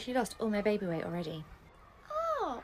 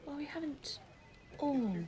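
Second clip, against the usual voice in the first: ordinary delivery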